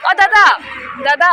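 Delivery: loud high call. Real, not acted. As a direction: shout for him across the way. Calling brother from afar.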